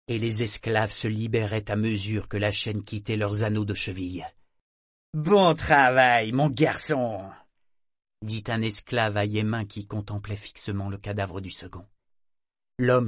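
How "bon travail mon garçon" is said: slow praise. Acted. Esclave félicite le garçon.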